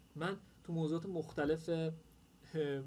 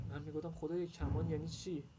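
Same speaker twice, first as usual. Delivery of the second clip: normal talking voice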